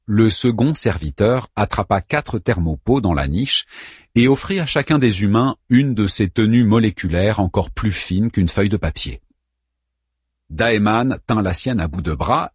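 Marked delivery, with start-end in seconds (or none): none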